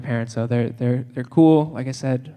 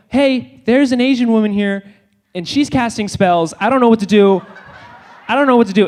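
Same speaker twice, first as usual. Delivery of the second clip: mock-panicked, higher-pitched alarm; worked-up, raised-pitch fretting; freaking out; 6 semitones higher than usual. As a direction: call for backup in a comic panic. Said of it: "so they start freaking out".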